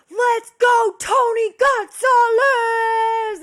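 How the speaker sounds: high, loud drawn-out sports yell; screamed cheer. Retold or imitated. Neighbor yelling for Tony Gonzalez across the courtyard, screaming. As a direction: belt it across the courtyard like a superfan. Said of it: "she, like, screams it super loud".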